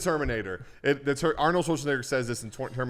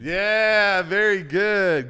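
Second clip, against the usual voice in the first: excited cheer; 8 semitones higher, 8 dB louder, much slower than usual. Real, not acted. Host cheers correct answer.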